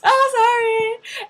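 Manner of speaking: high, loud, drawn-out slow apology; hammed-up sing-out apology; apologizing ajumma. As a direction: imitate an old ajumma calling sorry. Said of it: "the old ajumma was like".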